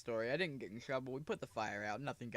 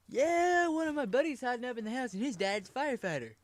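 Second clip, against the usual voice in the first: higher, louder goofy friend voice; 10 semitones higher, 5 dB louder than usual. Retold or imitated. Guy telling firemen about his buddy, higher and goofy. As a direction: blurt it out like a clueless buddy.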